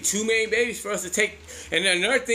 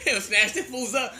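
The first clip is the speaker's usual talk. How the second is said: ordinary delivery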